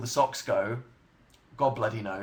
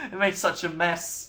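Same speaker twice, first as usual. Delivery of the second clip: ordinary delivery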